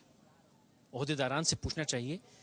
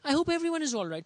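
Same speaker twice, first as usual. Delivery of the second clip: higher, mocking echo of "I hope everyone is all right."; scornful quote; 10 semitones higher, 3 dB louder, slower than usual. Reporter mocking his own written line, scornful and higher. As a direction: sneer the phrase back, higher than your own voice. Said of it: "پھر لکھ کے خود ہی شرم آئی".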